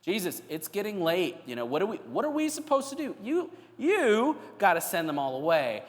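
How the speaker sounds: exasperated, pitch jumping up; disrespectful complaint. Acted. Tired disciples telling Jesus to send crowds away, disrespectful. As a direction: protest with mild disrespect. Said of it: "the disrespect of the disciples".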